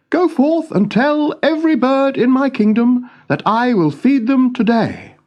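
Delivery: put-on a young king fretting over his birds.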